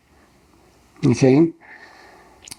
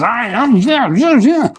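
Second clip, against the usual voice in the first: mock ostrich; 8 semitones higher, slower than usual. Imitating ostriches at the fence.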